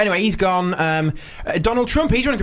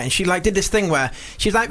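ordinary delivery

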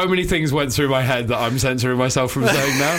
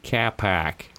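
lower, soft echo of Boston vowels; mimicked Boston accent; 6 semitones lower, 5 dB quieter than usual. Co-host echoes comic's car park, soft mock Boston accent. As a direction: quietly copy the Boston sound. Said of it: "That's Boston".

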